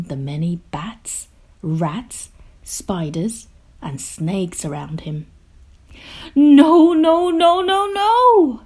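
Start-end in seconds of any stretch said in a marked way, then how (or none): 6.3-8.6 high, loud string of protests; shouting. A narrator's reading. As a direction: shout it out in one rising wail. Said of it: "shouted the group".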